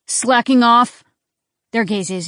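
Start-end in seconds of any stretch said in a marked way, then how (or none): 0.1-1.0 loud barked command; shouted order. A narrator's reading. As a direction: bark it across the room. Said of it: "projected his deep voice".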